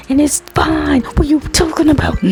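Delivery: high, incredulous outburst; exasperated rant. Real, not acted. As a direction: throw up your hands in disbelief.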